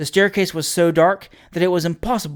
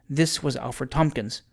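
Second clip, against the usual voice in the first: usual speaking voice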